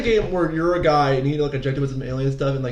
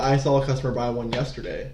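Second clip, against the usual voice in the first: normal talking voice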